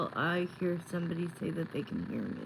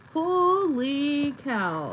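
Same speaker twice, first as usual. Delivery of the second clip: surprised; 7 semitones higher, 6 dB louder, much slower than usual. Real, not acted. Stunned someone can hear her.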